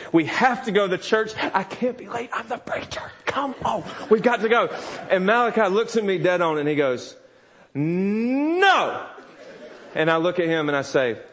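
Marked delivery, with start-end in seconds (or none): 0.1-4.7 high, strained plea sinking to near-whisper, then voice returns; exasperated pleading. Retold or imitated. Dad begging toddler to get ready for church, exasperated. 7.7-9.1 high, drawn-out mock "no"; defiant toddler refusal. Toddler refusing his preacher dad, mock defiance.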